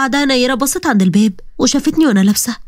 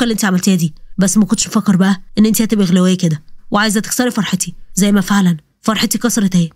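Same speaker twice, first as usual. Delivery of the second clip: lowered male voice, scolding sister; a man snapping at his sister; angry brother; 3 semitones lower than usual. Acted. Walid accuses sister. (أخ غاضب بصوت رجالي منخفض)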